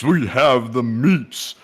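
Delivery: slow, drawn-out Arby's slogan read; ad announcer. Acted. Host joking about his old Arby's job, announcer voice. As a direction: stretch it like a commercial voice-over. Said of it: "my old job talking about Arby's".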